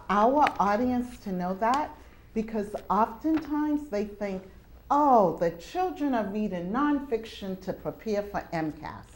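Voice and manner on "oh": usual speaking voice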